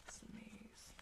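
soft whispered aside; whispering. Real, not acted. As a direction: breathe it as a secret aside.

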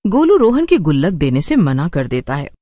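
ordinary delivery

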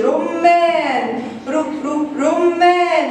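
رمان very drawn out, with short ر syllables between; first sound isolated. Shown as a demonstration. Teacher helping pupils find the first sound, sound isolated. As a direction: stretch رمان, repeat ر briefly, then رمان whole. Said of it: "إيه الصوت الأول؟".

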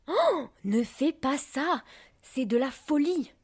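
loud, high, drawn-out protest; crying out. A narrator's reading. Other drops warning Perlette against her folly, crying out. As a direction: cry out to stop a reckless friend. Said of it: "crièrent les autres".

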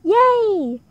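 high, loud storybook cheer; excited shout. A narrator's reading. Ozzy hears his pet can stay, shouting happily. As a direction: cheer it out, drawn long. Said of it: "Ozzy Otter shouted".

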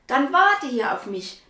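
pleading. Acted. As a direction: ask her friend to wait. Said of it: "bittet Hannah wieder". (Hannah bittet Charlotte, laut und höher)

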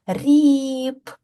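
"Ріб" with the "і" held long and high; stretched second sound. Shown as a demonstration. Teacher asks for the second sound, "Ріб" with a long "і". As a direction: hold the middle vowel of "Ріб". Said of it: "Який другий звук у першому складі".

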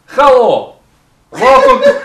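put-on loud, high Russian-accented hello breaking up; booming Russian accent collapsing into laughter; hammed-up Russian greeting. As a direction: overdo the Russian host, then crack up. Overdone retake, cracking up.

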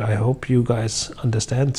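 ordinary talk

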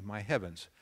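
usual speaking voice

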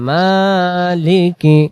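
মালিকি drawn out very slowly, each syllable held; slow drawn-out recitation. Shown as a demonstration. (ধীরে টেনে টেনে তিলাওয়াত)

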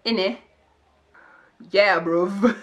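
loud London slang in a light British accent. Shown as a demonstration. Vlogger tries out her British accent, light cheeky London. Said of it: "start speaking like that".

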